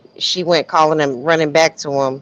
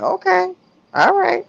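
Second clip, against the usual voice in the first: mock okay; 12 semitones higher than usual. Mocking reaction to the woman.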